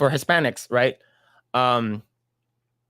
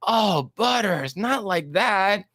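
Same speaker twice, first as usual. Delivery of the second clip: put-on higher, slow scolding kid voice; scolding Cartman; 5 semitones higher than usual. Cartman scolding Butters, high mock scolding. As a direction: scold him, high and drawn out. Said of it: "He's like".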